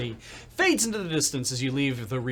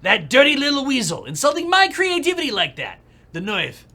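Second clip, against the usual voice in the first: loud, higher outraged New Yorker; angry producer cursing his rival; furious rant; 11 semitones higher, 10 dB louder than usual. Acted. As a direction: spit out the insult. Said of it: "composes himself".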